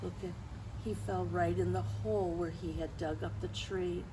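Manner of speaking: ordinary delivery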